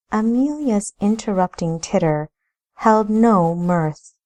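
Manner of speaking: plain reading voice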